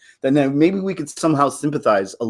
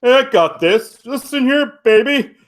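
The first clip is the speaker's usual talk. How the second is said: mock macho rescuer; 10 semitones higher, 3 dB louder than usual. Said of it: "jump in and go".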